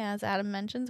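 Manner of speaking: ordinary talk, nothing marked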